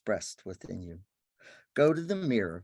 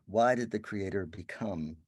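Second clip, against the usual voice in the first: ordinary talk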